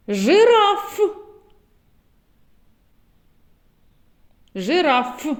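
Фонтан said high and clear, falling at the end; teacher's clear word. Shown as a demonstration. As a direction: call out Фонтан to the class. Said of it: "зловити звук Ф в словах". (чітко вимовлене слово Фонтан)

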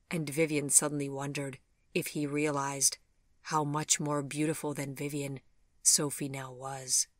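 plain reading voice

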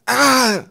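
high howl of pain; loud, drawn-out pained yell. A narrator's reading. Kaukab is jabbed by Twig's elbow, loud howl. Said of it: "heulte Kaukab noch lauter".

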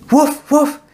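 very high happy woofs; joyful thankful barking; grateful barks. A narrator's reading. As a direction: bark thanks to the star. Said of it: "He jumps and barks".

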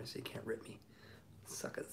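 soft teasing whisper of suckers; mock taunt. Musician teasing fans about ripping his songs, mock whispered taunt. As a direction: playfully taunt the viewers, hushed. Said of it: "I'm kidding".

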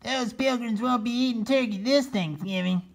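high proud turkey voice; gloating turkey. Acted. Solomon boasting to the hiding turkeys. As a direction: gloat over the Pilgrims. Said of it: "he shouted".

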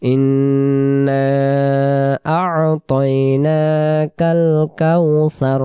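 slow chanted tajweed recitation; measured Quranic chant. Shown as a demonstration. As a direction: recite it slowly, drawing out the long vowels. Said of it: "আর শুরুতে গুণ না".

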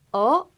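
어? rising sharply like a puzzled question; puzzled upward 어?; rising huh. Shown as a demonstration. Teacher shows second tone with a surprised 어?. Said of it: "아래에서 위로 올린다".

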